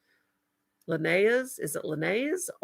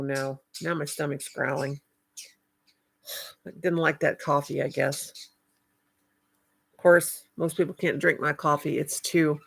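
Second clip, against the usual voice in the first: ordinary talk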